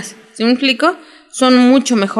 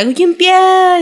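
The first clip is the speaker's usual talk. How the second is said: retold scream; 9 semitones higher than usual. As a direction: scream his name as in the show, much higher. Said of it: "la morra grita".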